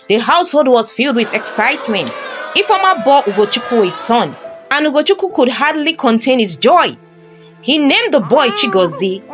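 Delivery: ordinary delivery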